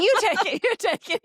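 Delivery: eager mock insistence; insistent pleading. Insisting husband take car.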